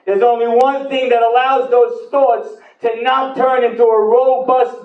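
high, slow, ringing sermon voice; full-force preaching. Acted. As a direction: preach it to the whole room. Preaches about Torah.

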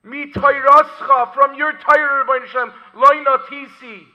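loud, high spoken Hebrew with translation; impassioned plea to God; fervent declaiming. Retold or imitated. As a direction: proclaim the verse forcefully, spoken instead of sung. Sung verse now declaimed.

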